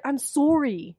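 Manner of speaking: hammed-up Canadian apology; Canadian accent. Imitating his apology to her, Canadian accent. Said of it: "If that is not Canadian".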